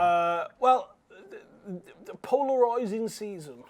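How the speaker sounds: drawn-out put-on accent, POLARISING broadened. Real, not acted. Co-host introducing the polarising season, in a put-on accent. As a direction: say polarising in an accidental accent, its RIS like "roys".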